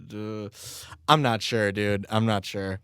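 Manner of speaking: ordinary talk, nothing marked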